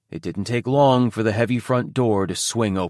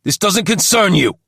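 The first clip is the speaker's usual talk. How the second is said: loud, higher angry snarl; snarled order; 8 semitones higher, 6 dB louder than usual. A narrator's reading. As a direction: snarl him back to the kitchen. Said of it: "Toby snarled".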